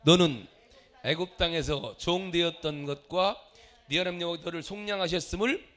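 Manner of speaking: loud, low, measured congregational reading; scripture reading aloud. Real, not acted. Preacher leading the church through Deuteronomy, solemn loud reading. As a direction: lead the congregation in reciting the verse.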